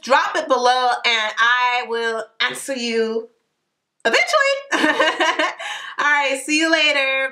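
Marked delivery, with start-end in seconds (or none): 4.0-4.6 a sudden high, playful "eventually"; teasing high "eventually". Acted. Promising viewers answers, a teasing high note. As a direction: toss it up high as a tease.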